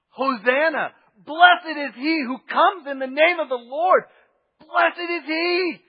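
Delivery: loud, high ecstatic cry; joyful shouting. Retold or imitated. Crowd greeting the king, loud joyful shouting. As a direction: shout it at the top of your lungs. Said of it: "They're going".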